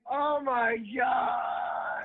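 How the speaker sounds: high, drawn-out cry; real loud, high exclamation on getting through; excited shout. Real, not acted. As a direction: shout it slowly, stretching each word. Caller finally gets through.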